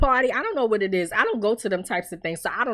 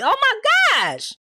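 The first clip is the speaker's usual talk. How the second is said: excited; 4 dB louder than usual. Real, not acted. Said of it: "exclaims over next plot twist".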